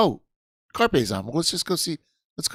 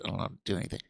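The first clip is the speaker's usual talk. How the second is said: muttered aside; 5 semitones lower, 9 dB quieter, much faster than usual. Real, not acted. Self-deprecating aside.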